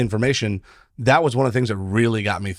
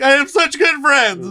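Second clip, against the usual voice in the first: high, slow hammed-up sobbing words; mock blubbering; 5 dB louder, slower than usual. Telling the attorney about the friends' benefit, slow high mock sobbing. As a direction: blubber it out through fake tears. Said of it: "I was just ugly crying".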